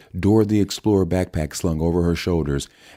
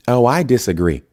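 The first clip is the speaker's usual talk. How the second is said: a light hint of a Southern accent; 7 semitones higher, 5 dB louder than usual. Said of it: "said in a thick Southern accent".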